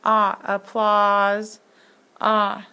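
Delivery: ah held, applause slowed with the ah stressed; sounding out ah. Shown as a demonstration. Teacher modelling the ah sound for the class. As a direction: stretch ah, then applause slowly. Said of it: "a word that has an ah sound".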